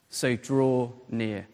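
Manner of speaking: "so draw near" drawn out low and soft; slow emphasis. Real, not acted. Preacher repeats his first point to the church, slow and deliberate. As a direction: linger on each word of "so draw near".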